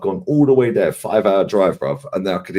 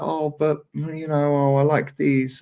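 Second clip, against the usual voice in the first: put-on coaxing girlfriend; 7 semitones higher, 3 dB quieter, slower than usual. Imitating girlfriend shopping.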